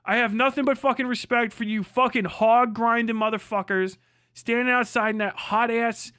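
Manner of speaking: ordinary delivery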